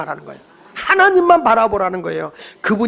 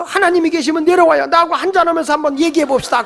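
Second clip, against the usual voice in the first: higher-pitched mock challenge to God; retold boozy dare, pitched up; upset drinker's challenge; 7 semitones higher than usual. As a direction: call God down for a talk, imitating an upset drinker. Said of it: "어떤 분은 속상하니까 소주 들고".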